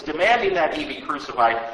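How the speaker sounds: usual speaking voice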